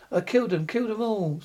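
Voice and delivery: plain reading voice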